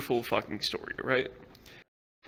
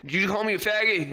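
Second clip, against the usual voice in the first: high, affronted accusation; mock outrage; 6 semitones higher than usual. Crackhead getting into it with officer, mock outrage. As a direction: imitate someone picking a quarrel. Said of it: "they're starting to get into it".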